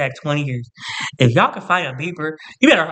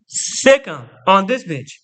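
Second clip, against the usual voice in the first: mock attack command; 3 semitones higher, 3 dB louder, slower than usual.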